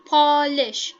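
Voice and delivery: Polish split loudly into POL and ISH; syllable demonstration. Shown as a demonstration. Teacher proving to students there are two syllables, Polish split loudly. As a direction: sound out Polish in two long, loud beats. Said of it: "there are two syllables".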